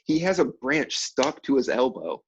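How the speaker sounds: usual speaking voice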